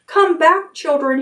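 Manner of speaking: high desperate call; crying out. A narrator's reading. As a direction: beg them to come back. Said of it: "he cried".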